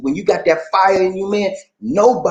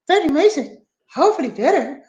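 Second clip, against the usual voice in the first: high, stretched-out mock greeting; high-pitched hammed-up impersonation; put-on high voice; 13 semitones higher, slower than usual. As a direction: redo it up high, drawn out. Host redoes her message voice.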